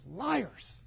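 put-on a child's sudden indignant cry, pitched high; mock outraged exclamation. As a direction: fling it out like a kid who caught the grown-ups lying. Child [name] exclaims at newspaper.

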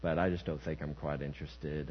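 soft, low, mild polite refusal; lightly voiced man, back to himself; ordinary man's voice. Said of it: "it's back in [name]'s voice".

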